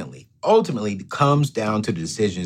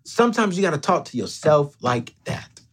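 normal talking voice

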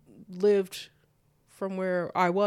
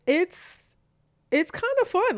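drawn-out, much higher voice; hesitant high-pitched answer; 11 semitones higher than usual. Retold or imitated. Daughter answers dad about being a mom, hesitant and high. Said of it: "Not like that".

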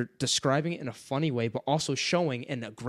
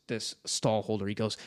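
normal talking voice

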